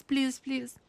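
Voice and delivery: ordinary talk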